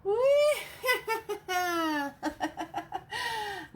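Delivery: long high cackling witch laugh; witch cackle. Acted. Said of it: "Now I'm just being silly".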